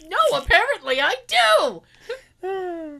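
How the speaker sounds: loud, high, drawn-out sassy retort; childish defiance. Retold or imitated. Patient turning round on the doctor, childishly defiant. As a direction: drag it out like a petulant child. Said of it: "act like a child about it".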